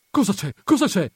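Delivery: high, loud alarmed questioning; panting, urgent question. A narrator's reading. Perpetua arriving panting at the brothers, urgent questioning. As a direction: fire it out twice, as if just run up. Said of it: "domandò Perpetua ansante".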